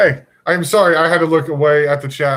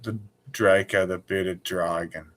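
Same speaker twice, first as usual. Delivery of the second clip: put-on low, slow, soft Australian accent; 6 semitones lower, 7 dB quieter than usual. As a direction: ease into an Aussie drawl, quietly. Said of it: "How do you say dragon in an Australian accent?".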